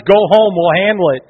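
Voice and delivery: 1 stern order. Retold or imitated. Police ordering the father away.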